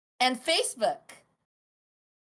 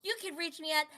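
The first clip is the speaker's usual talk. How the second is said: cutesy intro voice; 5 semitones higher, 4 dB louder than usual. Retold or imitated. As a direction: put on a bubbly host voice. Mimicking her own clip intro.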